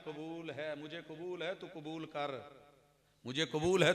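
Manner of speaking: ordinary talk, nothing marked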